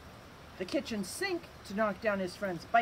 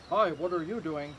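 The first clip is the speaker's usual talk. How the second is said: lower, odd voice for the whale; curious whale; 5 semitones lower than usual. Acted. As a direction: greet him like a puzzled sea creature. The whale greets Floyd.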